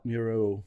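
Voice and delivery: "Mural" with an ee slipped in, myoor-eel; trial pronunciation. Shown as a demonstration. Trying the word both ways.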